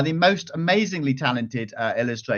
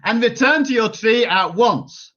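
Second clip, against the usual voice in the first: ordering; 6 semitones higher, 4 dB louder than usual. A narrator's reading. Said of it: "Ordered Rapunzel's mother".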